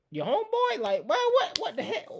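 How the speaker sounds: very high, incredulous squawk; baffled disbelief. Real, not acted. Reactor stunned by the homeboy story, high-pitched disbelief. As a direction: splutter upward in amused disbelief.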